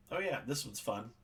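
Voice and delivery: ordinary talk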